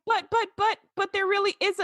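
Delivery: put-on a kid trying to warn about the monster; child stammering that there really is a monster; mock stammering kid. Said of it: "And the kid's like".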